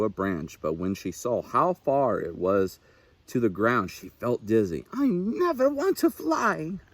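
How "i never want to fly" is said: high, frightened owlet cry; a little owl backing away in fear; crying out scared. A narrator's reading. Little Owl refusing to fly from the high branch, high and frightened. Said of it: "Little Owl cried".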